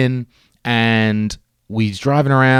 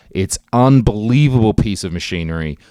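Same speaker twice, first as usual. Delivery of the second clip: ordinary talk, nothing marked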